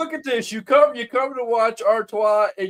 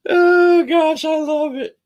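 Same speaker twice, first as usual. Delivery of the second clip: laughing; 4 semitones higher, 5 dB louder, slower than usual. Real, not acted. Laughing at the dance battle.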